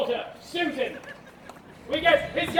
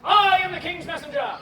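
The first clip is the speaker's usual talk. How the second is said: loud, high theatrical herald; hailing loudly, first word hit hard; calling out a hail; 5 semitones higher, 6 dB louder than usual. Acted. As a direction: announce it like a royal messenger. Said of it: "And she calls to Macduff".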